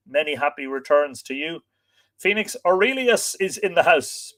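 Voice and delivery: ordinary talk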